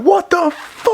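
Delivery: high stunned "what the fuck"; shocked exclamation. Retold or imitated. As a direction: blurt it out in disbelief. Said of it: "Like, I lost it".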